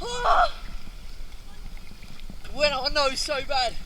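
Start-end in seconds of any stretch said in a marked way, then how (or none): 0.0-0.5 high scream mid-jump; on a trampoline. Real, not acted. Jumps off trampoline.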